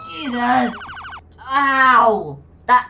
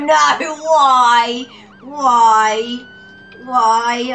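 rising panicked yell; panicked shout; 3 semitones higher, 4 dB louder, much slower than usual. Real, not acted. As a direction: cry out in alarm. Caught by police, panicked.

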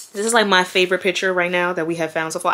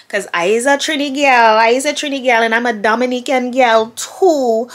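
a light Trinidadian accent, a bit louder; 7 semitones higher, 6 dB louder than usual. Real, not acted. To viewers, proudly claiming her roots, light Trinidadian accent. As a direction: lean into a playful Trini accent. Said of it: "in little Caribbean accents".

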